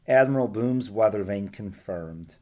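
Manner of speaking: ordinary delivery, nothing marked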